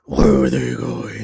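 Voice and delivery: mock brooding superhero, slow and heavy. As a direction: drag it out in a put-on vigilante voice. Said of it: "the Bale voice".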